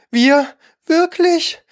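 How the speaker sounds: very high outraged mouse; trembling indignation. A narrator's reading. Mouse rebuffs Alice's talk of cats, indignant. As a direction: squeak it in offended disbelief. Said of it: "die bis zur Schwanzspitze zitterte".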